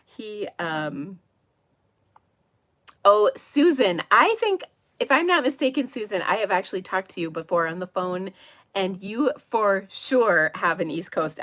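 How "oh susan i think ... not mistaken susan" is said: higher, louder burst of recognition; real pleased surprise, pitched up and loud; sudden recognition. Real, not acted. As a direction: call out to someone you just realised you know. Suddenly recognises a viewer in chat.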